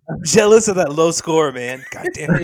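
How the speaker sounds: loud, high-pitched jealous outburst; mock mad. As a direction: blurt it out loud in playful outrage. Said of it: "are you mad?".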